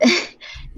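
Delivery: a quick amused laugh; short laugh. Real, not acted. Amused by the yawning question.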